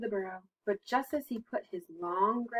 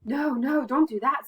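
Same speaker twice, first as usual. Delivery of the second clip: loud, raised storybook protest; mock-alarmed plea; alarmed warning; 5 semitones higher, 9 dB louder than usual. A narrator's reading. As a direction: urgently warn her off, playfully dramatic. Animals warn Mama Frog in alarm.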